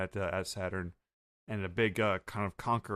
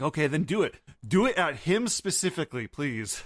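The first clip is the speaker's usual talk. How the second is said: demanding Bonnie; 6 semitones higher, 4 dB louder than usual. Retold or imitated. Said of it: "Bonnie's like".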